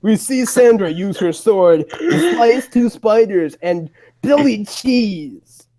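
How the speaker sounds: ordinary talk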